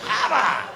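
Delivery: a loud, straining grunt; comic grunt of effort. Retold or imitated. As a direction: heave it out like a hard push. Suzanne forcing herself.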